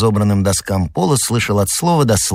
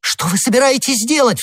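high, frantic plea; alarmed cry. A narrator's reading. Madame Bonacieux cries out as d'Artagnan draws his sword. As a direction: cry out to stop him. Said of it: "вскричала госпожа Бонасье".

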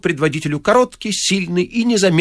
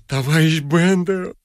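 drawn-out, higher plea; pleading; 4 semitones higher, slower than usual. A narrator's reading. Vorobyaninov begging Bender once more, pleading. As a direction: plead slowly in another man's voice. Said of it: "взмолился Воробьянинов".

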